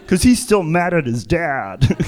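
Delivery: ordinary talk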